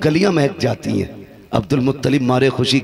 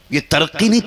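normal talking voice

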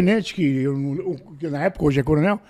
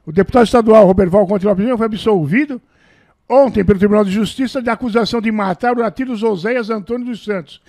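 louder, steady headline reading; reading his old newspaper acquittal aloud like a news report; 6 dB louder than usual. Acted. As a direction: read the clipping like a news anchor. Reads newspaper clipping aloud.

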